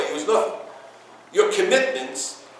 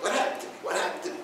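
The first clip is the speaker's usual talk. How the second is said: soft, stunned question; hushed dismay; 5 semitones higher, 11 dB quieter, faster than usual. Retold or imitated. Ill man asking the rabbi, hushed dismay. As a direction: ask it almost under the breath.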